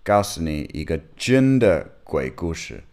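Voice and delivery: Mandarin with a foreign accent; non-native speaker talking Chinese; foreigner speaking mandarin. Real, not acted. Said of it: "我的中文不太好".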